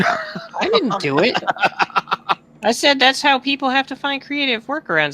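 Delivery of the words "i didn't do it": mock innocent. High denial, playfully defensive.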